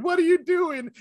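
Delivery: high-pitched mock scream; hammed-up hysterical yell. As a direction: shriek it in outraged disbelief. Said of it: "five straight minutes of her screaming".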